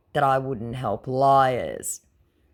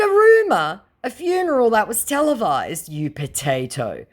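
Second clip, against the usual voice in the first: mocking disbelief; incredulous scorn; 8 semitones higher, 4 dB louder than usual. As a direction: scoff at the scammer. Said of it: "I said".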